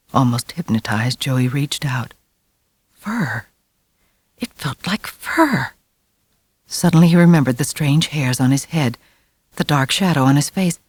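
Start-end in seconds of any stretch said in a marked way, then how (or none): none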